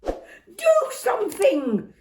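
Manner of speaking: high, loud, slow panicked shout. Acted. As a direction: yell at the family to act. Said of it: "shouted Dad".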